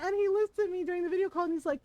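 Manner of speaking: normal talking voice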